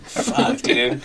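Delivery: high, soft breathy swear; a quiet, half-whispered mock tic outburst; lightly voiced girl blurting a swear; imitating tic blurt. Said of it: "She's like".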